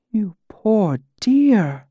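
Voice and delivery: high, slow, sighed sympathy; drawn-out, tender pity. A narrator's reading. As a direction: sigh it out slowly in a high, pitying woman's voice. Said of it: "she sighed almost too quietly for me to hear".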